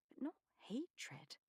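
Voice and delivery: breathy whisper. A narrator's reading. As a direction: whisper it, hurt. Narrator whispers.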